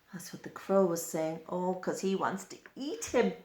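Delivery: plain reading voice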